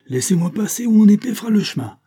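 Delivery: high, loud challenge; shouted threat. A narrator's reading. Lieutenant to the count blocking the door, shouted threat. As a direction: threaten loudly to force the way through.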